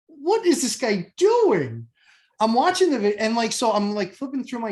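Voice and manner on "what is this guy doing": loud, slow disbelieving question; what is this guy doing, slowed; exasperated disbelief. Retold or imitated. Reacting to video.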